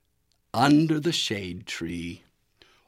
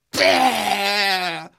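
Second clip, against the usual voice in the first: high, loud disgusted "Bah!"; spitting bah; 10 semitones higher, 5 dB louder than usual. A narrator's reading. Goose spitting out mud ice cream, shrill spit. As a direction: spit it out with a shriek. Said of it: "said Goose as she spit".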